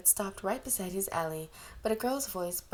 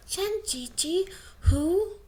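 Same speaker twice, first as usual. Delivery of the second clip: put-on very high, loud little-kid question; tiny boy tugging his carer's hand; small child; 11 semitones higher, 8 dB louder, much slower than usual. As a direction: squeak it out like a toddler.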